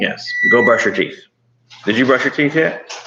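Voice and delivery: stern parent command. Real, not acted. Mom pushing kid to brush.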